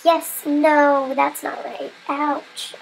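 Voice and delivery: normal talking voice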